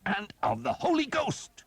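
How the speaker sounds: very high, loud holy war cry; shouted prayer. A narrator's reading. Priest mid-fight, roaring high and loud. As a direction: roar the last line of the blessing.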